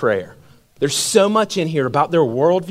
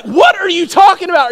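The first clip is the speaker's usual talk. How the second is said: incredulous shout; 11 semitones higher, 4 dB louder than usual. Retold or imitated. Accused by the brawlers.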